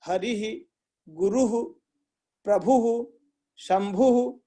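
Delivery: गुरु, प्रभु, शंभु each ending in an echoed "-hu", हरि ही in "-hi"; visarga vowel echo. Shown as a demonstration. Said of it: "जो भी स्वर है उसको बोलिए". (हरि ही, गुरु, प्रभु, शंभु के अंत में ह के साथ स्वर की गूंज)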